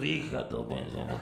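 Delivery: low, slow sleazy mutter; dad's sleazy mutter. Retold or imitated. Dad grinding on mom at party, low slow mutter. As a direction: growl it like a horny dad. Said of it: "He's just".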